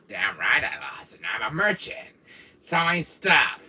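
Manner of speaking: low rough villain voice; low mock-villain comeback; in-character retort. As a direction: growl it back low, in character. Answers in the put-on Wesker voice.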